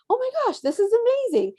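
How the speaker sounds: high, bright squeal of delight; thrilled mom-to-be in labor; excited delight. Retold or imitated. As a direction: gush with sudden joy. Thrilled to be five centimeters.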